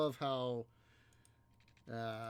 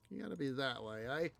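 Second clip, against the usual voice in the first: normal talking voice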